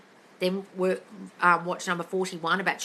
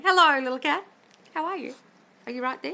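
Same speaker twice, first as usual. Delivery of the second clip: cooing to a cat; 7 semitones higher, 3 dB louder than usual. Real, not acted. Coach greeting a listener's cat, high cooing. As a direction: coo high at a little pet.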